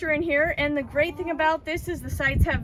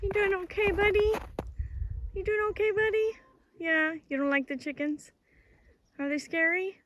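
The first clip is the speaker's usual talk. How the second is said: higher, gentle coaxing voice; soothing a dog; 7 semitones higher, 3 dB quieter than usual. Real, not acted. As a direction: comfort the nervous puppy. Comforting a scared dog.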